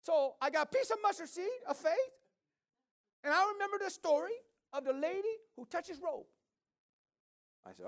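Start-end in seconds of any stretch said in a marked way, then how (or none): 0.0-6.3 put-on much higher, louder voice of the woman in her accent; imitating the woman. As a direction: lift into her high, earnest voice. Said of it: "But she said".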